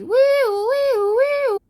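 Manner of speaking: high, wailing siren imitation; police siren. Police coming after the separatists, imitated siren. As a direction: swoop up into a mock siren wail.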